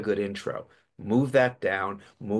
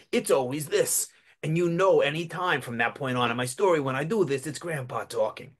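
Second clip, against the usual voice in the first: put-on raised, gravelly old grandpa voice; 5 semitones higher than usual. Shown as a demonstration. Storyteller demonstrating his character voice, gravelly grandpa. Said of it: "he didn't have a gravelly accent".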